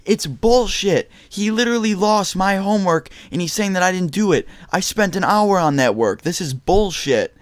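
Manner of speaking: high, indignant protest; retold angry protest. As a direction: plead your case in outrage, stretching the curse. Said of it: "I was getting so mad".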